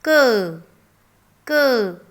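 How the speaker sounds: क said as a short crisp kuh, repeated; teacher repeating the sound क; phonic sound demo. Shown as a demonstration. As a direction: model क clearly for kids. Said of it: "C is".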